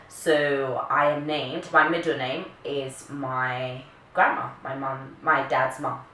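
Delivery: ordinary talk, nothing marked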